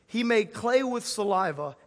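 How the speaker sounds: ordinary delivery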